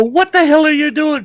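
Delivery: very high, loud yell; shocked shout at his wife. Retold or imitated. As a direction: bellow at her in disbelief.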